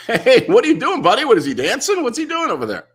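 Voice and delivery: high, loud goofy dad voice calling out; hammed-up clueless bystander, loud and high; mocking clueless dad. Mocking a clueless dad who calls out loudly across the room.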